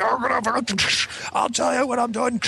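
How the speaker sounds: high, strained mock yell; raging dad. Dad brushing off mom while beating kid, imitated shout. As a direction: rant like a dad mid-beating.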